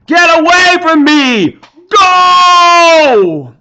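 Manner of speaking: high, loud, slow furious roar; roaring in rage. A narrator's reading. Calm lizard boy finally roaring at the bats in rage. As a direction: bellow at them in humiliated rage. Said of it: "he roars".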